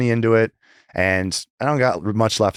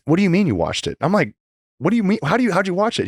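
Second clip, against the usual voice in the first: higher, incredulous questions; pitched-up stunned what do you mean; retold disbelief; 10 semitones higher, faster than usual. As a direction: replay the shock of finding out. Retold shock at being livestreamed.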